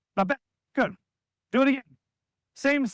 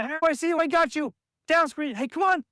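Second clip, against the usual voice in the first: higher call, I see you, come on; eager court talk, pitched up; calling out to a teammate; 7 semitones higher than usual. Shown as a demonstration. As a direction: shout it across the court to your teammate. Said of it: "Make you just say".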